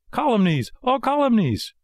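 very high puppet protest; indignant protest. A narrator's reading. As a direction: reject the slander in a high voice. Pinocchio protests the slander.

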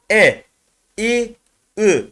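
short separate syllables E, İ, Ü called out; vowel drill. Shown as a demonstration. Teacher reading the vowels to students, crisp separate calls. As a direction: call each vowel crisply, one by one. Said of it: "Bunu böyle ezbere gitmek".

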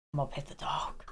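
breathy hushed I'm gonna pet the dog; whispered. Real, not acted. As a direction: sneak it past the cat. Whispered defiance.

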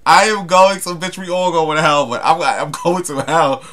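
ordinary delivery

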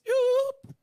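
high-pitched playful "yoop"; comic high yelp. Retold or imitated. Preacher acting out his reaction, comic high yelp. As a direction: let out a silly high whoop. Comic exit sound in story.

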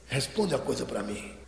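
low, soft, confiding voice; hushed, deep-pitched coaxing; charismatic. Acted. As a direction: speak gently, as if arm round a friend.

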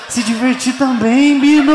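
slow, rising plea to Bino; loud pleading. Acted.

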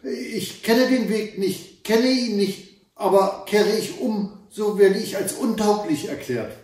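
put-on high, startled girl's voice, hesitant at first; frightened stammer. A narrator's reading. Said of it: "Das Mädchen erschrak und stotterte". (erschrockenes, hohes Stottern)

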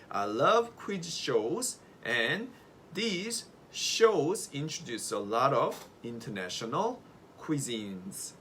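very slow, every word stretched; slow model sentence. Shown as a demonstration. Teacher dictates a long example sentence, very slowly. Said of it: "한 문장으로 얘기를 해보죠".

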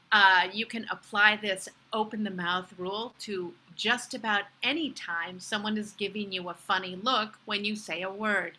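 normal talking voice

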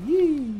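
squealed, drawn-out "yee"; high-pitched whoop. Real, not acted. Exclaiming during play.

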